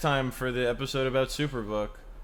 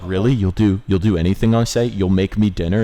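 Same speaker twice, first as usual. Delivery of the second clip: loud eager dad; 9 dB louder than usual. Retold or imitated. Dad voiced in retold scene.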